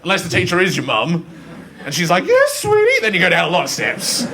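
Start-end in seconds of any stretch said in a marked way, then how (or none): 2.2-3.0 very high-pitched mock mother; doting mum voice. Teacher mum to child in class, doting high voice. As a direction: coo it like an indulgent mum.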